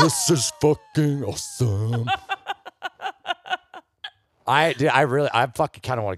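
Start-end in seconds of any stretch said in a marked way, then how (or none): 0.0-2.1 slow stoner drawl. Retold or imitated. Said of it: "I'm like". 2.2-3.8 shrill burst of laughter; high squealing laugh. Real, not acted. Big laugh after joke.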